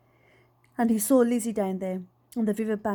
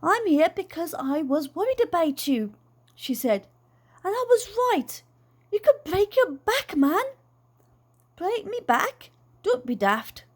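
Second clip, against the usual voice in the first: worried daughter; 7 semitones higher than usual. A narrator's reading. Daughter and dad argue.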